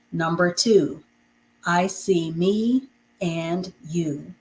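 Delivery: slow, word by word homework sentences; slow dictation. Shown as a demonstration. Teacher dictating homework sentences to students. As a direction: dictate each sentence slowly for writing. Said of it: "I know I'm gonna be saying these quickly".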